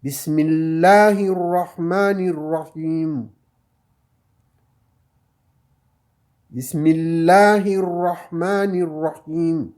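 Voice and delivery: Bismillahirrahmanirrahim, slow with the final m silent of vowel; slow Quran recitation. Shown as a demonstration. Teacher reading the verse aloud to the class, slow recitation. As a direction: recite it slowly and carefully. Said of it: "vous entendez comme dit Rahim".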